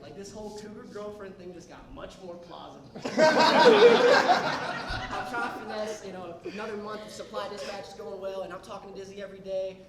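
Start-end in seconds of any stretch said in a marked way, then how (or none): 3.2-5.3 loud high-pitched laugh; high burst of laughter. Real, not acted. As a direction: crack up hard. Laughs at own story.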